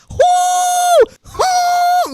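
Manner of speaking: loud high whoo; excited falsetto whoop; high whooping shout. Retold or imitated. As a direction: whoop like a rollercoaster drop. Celebrating near miss.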